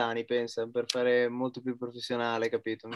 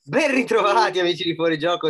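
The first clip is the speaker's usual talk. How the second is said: announcer; 6 semitones higher, 9 dB louder than usual. Acted. Host opening greeting.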